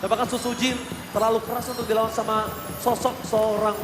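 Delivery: ordinary delivery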